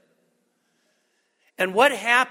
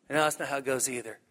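ordinary delivery

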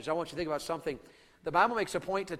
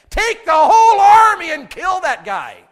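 high, loud, drawn-out urging; exasperated shout; 9 semitones higher, 14 dB louder, slower than usual. Acted. Preacher urging Saul to use his army, exasperated shouting. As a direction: shout it like an order to attack.

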